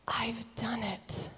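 hushed, whispery declaration; tender assurance. Acted. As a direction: say it very softly, as a personal gift. Preacher voices Jesus speaking to us.

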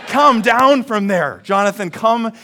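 mother calling her son off the roof; mom yelling. Retold or imitated. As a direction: plead loudly with the child. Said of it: "my mom is yelling".

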